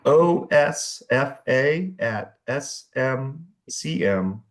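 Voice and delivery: slow, letter-by-letter spelling; over enunciating. Shown as a demonstration. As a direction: spell out each letter slowly and clearly. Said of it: "say that again one more time slowly".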